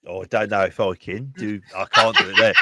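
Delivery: bad Brummie accent drifting towards New Zealand; shaky-footing Brummie accent, vaguely New Zealand; attempted Brummie accent. Shown as a demonstration. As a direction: try a Brummie accent and miss. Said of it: "It sounded vaguely New Zealand there".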